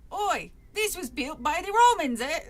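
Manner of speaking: put-on Cockney accent. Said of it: "how Marsland talks to Trap".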